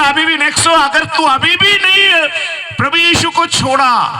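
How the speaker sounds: threatening commander. Acted. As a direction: press the threat hard.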